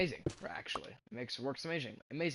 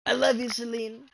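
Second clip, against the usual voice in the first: light Trump impression, higher and much louder; a hint of Trump, declared loud and high; 8 semitones higher, 10 dB louder than usual. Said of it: "Talking in this Trump voice".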